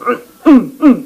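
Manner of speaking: high, short pleading groans; groaning prayer. Shown as a demonstration. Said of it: "주여란 뜻이에요". (높은 음으로 짧게 신음하듯 기도)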